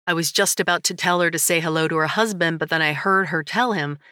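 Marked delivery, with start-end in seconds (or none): none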